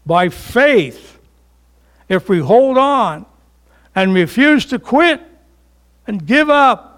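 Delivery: climbing to a high, forceful pulpit cry; impassioned preaching. Real, not acted. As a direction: build phrase by phrase with stretched key words.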